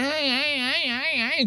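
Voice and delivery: short high grunts, mocking the comedian; mock grunting noises. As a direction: ape his weird grunts in a high voice. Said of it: "You're going".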